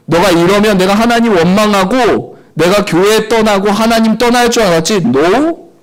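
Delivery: loud, high, taunting challenge; defiant shouting. Retold or imitated. Preacher defying God after his mother's injury, loud taunt. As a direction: throw the dare at the sky. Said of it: "하늘을 향해 소리쳤어요".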